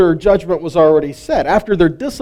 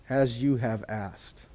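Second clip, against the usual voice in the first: hushed slow reading; 5 semitones lower, 14 dB quieter, slower than usual. Acted. God's reply read from text.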